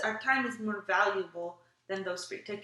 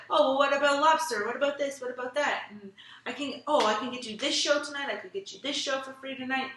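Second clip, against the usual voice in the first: pushy saleslady; 4 semitones higher, 3 dB louder than usual. Retold or imitated. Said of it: "then she's like".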